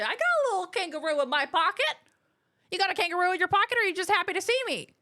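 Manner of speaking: cheeky pickup line. Pretend.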